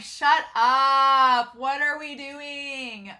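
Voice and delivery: loud, drawn-out exclamation; overwhelmed fan outburst. Retold or imitated. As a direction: wail it loudly, dragging out the words. Fan's overwhelmed reaction to shades scene.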